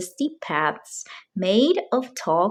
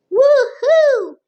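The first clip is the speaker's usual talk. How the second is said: loud high cheer; much higher, louder woohoo; joyful whoop; 8 dB louder than usual. A narrator's reading. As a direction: whoop with delight on the ride. Family on bumpy wagon ride.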